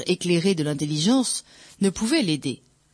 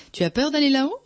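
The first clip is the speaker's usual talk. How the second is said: higher, rising mock-awkward question; lightly feigned clumsy taunt, pitched up; deliberate clumsiness; 9 semitones higher, faster than usual. A narrator's reading. Narrator taunting the priest about climbing, deliberately clumsy. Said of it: "avec une maladresse voulue".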